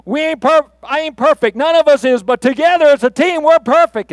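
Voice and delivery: loud, high, fired-up halftime speech; rally teammates with fierce conviction; Julius Campbell. Acted. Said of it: "halftime speech in Remember the Titans".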